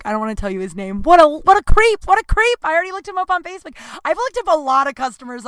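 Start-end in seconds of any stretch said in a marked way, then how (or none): 1.0-2.6 shrieked high and loud at herself; mock self-scolding. Real, not acted. Teasing herself for stalking him, a high mock shriek. 2.6-5.5 much higher and rushed, giddy; flustered rush. Real, not acted. Confessing her Facebook snooping in a high flustered rush.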